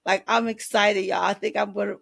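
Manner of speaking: normal talking voice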